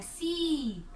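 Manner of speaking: "सी" with a long, falling ee; forceful, stretched vowel. Shown as a demonstration. Teacher modelling forceful pronunciation for pupils, long drawn-out ee. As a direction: hold the vowel of "सी" firmly. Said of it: "जोर से खींच कर बोलना है".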